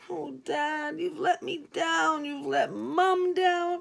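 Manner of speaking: rising, slow dismay; slow, let-down lament. Acted. Inner cry on hearing dad.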